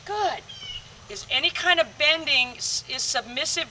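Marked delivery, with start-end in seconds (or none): 0.1-0.4 quick praise. Real, not acted. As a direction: toss the dog a quick praise. Praising dog mid-sentence.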